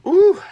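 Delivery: very high, loud "ooh"; sudden high yelp of discomfort; stung whoop. Real, not acted. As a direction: whoop as fumes hit your eyes. Reeling from a strong onion.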